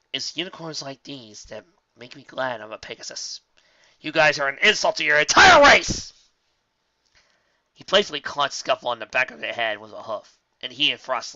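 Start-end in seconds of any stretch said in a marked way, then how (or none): 4.0-6.1 playful shout, higher; loud mock insult. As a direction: jeer at the colts. Mocking insult.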